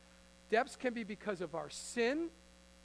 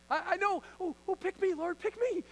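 put-on much higher, louder, an overeager kid begging to be picked; eager Peter; 9 semitones higher, 5 dB louder than usual. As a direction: bounce in your seat, dying to be chosen.

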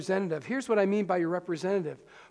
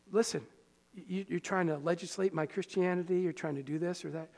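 normal talking voice

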